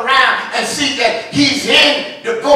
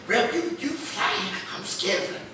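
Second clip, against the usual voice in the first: a timid churchgoer; 3 semitones lower, 9 dB quieter than usual. Retold or imitated. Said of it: "Some folk".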